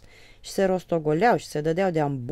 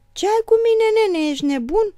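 much higher and louder, outraged; indignant shout; 11 dB louder than usual. A narrator's reading. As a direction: yell it at your brother, offended. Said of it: "strigă Nicolae indignat". (strigăt indignat)

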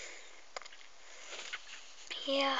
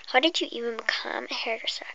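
whisper; 3 semitones lower, faster than usual. Acted. Whispering to the viewer before the pretend hairdresser game. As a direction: breathe every word like an ASMR intro. Customer whispers in role play.

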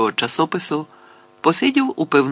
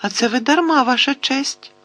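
very high, guileless voice; high-pitched mock-innocent protest; innocent little voice; 12 semitones higher than usual. A narrator's reading. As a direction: answer sweetly in a thin, high voice. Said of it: "тим самим невинним голоском".

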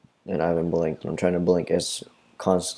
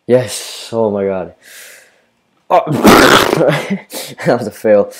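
laughing shout; 4 semitones higher, 10 dB louder, slower than usual. Real, not acted. Game moment, laughs.

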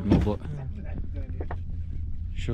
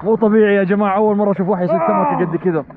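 excited praise; 8 semitones higher, 4 dB louder than usual. Real, not acted. Praising big catch excitedly.

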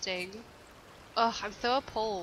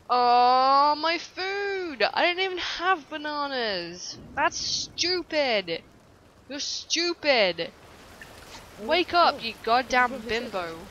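loud, higher outraged shouting at the screen; angry yelling; 4 semitones higher, 7 dB louder, slower than usual. Real, not acted. Gamer yelling at her sim over stolen food, loud outrage. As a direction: yell at the useless sim.

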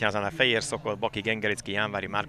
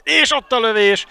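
excited commentary; 11 semitones higher, 9 dB louder than usual. Real, not acted. Shot called live.

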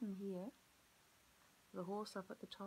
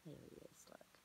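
soft unvoiced whisper; 11 dB quieter than usual. Real, not acted. Teacher whispering to children that Lamb is found. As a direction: whisper the discovery.

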